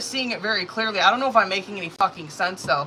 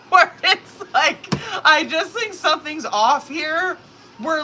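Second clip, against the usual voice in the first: talking through laughter, higher and louder; laughing; 7 semitones higher, 4 dB louder than usual. Real, not acted. Tarot reader still laughing to her viewers. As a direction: say it while still laughing, slowly.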